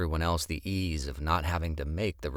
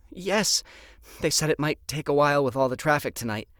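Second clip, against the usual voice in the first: fast, high anxious answer; anxious hostess; 9 semitones higher, 5 dB louder than usual. Acted. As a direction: rush it out nervously.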